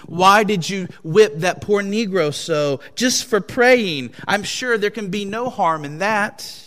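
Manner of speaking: put-on young wife pleads for the whipped slave; pleading wife. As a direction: beg him to explain.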